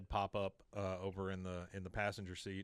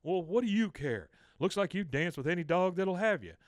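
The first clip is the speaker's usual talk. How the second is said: high, loud snappy comeback; jealous retort; 7 semitones higher, 6 dB louder than usual. Retold or imitated. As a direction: snap back jealously.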